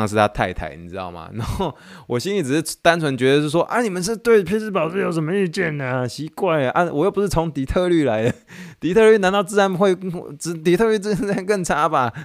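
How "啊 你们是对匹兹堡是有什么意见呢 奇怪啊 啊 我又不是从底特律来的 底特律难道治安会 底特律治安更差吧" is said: mock indignant. His inner indignant reply.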